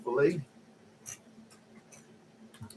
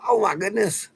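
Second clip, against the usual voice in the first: much higher, retold gasp of amazement; imitating the nurse amazed at his Facebook photo; 11 semitones higher than usual. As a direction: mimic her delighted surprise. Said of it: "She goes".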